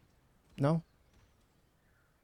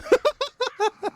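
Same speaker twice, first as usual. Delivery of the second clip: high burst of laughter; a sudden high cackle at chat; loud laugh; 3 dB louder than usual. Real, not acted. Streamer bursts out laughing at chat, high cackle.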